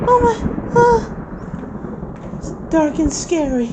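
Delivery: put-on high loud baby fear; frightened baby voice. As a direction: cry out like a terrified toddler.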